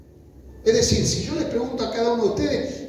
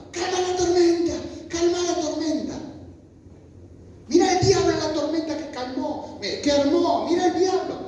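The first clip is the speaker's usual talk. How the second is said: high, insistent mock cries; imitated shouting; 8 semitones higher than usual. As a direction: call out like frightened disciples, pitched way up. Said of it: "Yo creo que así gritaban". (gritos agudos imitados, insistentes)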